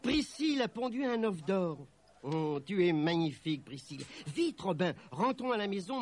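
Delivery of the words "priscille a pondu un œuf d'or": higher-pitched, amazed announcement; wondering, raised voice; excited exclamation. A narrator's reading. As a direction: announce the marvel in astonishment. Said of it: "s'écria Nelly".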